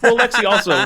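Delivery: giggling start of a reply; laughing. Real, not acted. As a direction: break in with a laugh.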